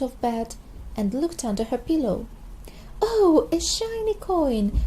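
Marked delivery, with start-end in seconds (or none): 3.0-4.8 high, slowed-down thrilled squeal; excited shout. A narrator's reading. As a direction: burst out with delight at the coin. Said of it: "she shouted".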